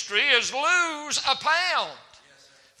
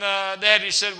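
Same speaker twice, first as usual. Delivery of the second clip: ordinary talk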